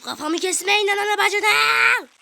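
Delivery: loud, slow, high yell; panicked shout. Acted. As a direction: scream for your head. Kafası kesilirken bağırıyor. (kafası kesilirken bağıran panik çığlığı)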